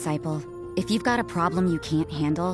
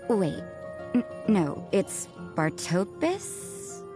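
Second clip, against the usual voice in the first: unsure fumbling; 3 semitones higher, 4 dB quieter, much slower than usual. Acted. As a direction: grope slowly for the right name. Fumbling a name in a blessing.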